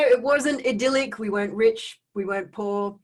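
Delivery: usual speaking voice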